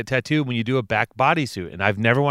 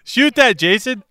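high, loud call to the cameraman; insistent, giddy shout; urgent amused call; 10 semitones higher, 4 dB louder, slower than usual. Real, not acted. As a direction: call out excitedly, pitched way up. Laughing, urging cameraman to film.

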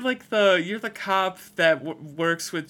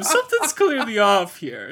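ordinary delivery

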